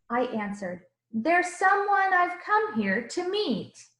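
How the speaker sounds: put-on lively rhyming read-aloud for children; storybook reading. A narrator's reading. Teacher reading a picture book to the class, animated. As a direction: read the verses with bounce and warmth.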